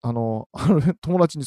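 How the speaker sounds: ordinary talk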